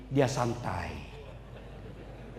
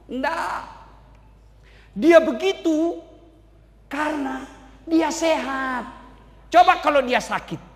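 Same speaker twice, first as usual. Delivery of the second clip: scoffing Satan; 4 semitones higher, 4 dB louder, slower than usual. Acted.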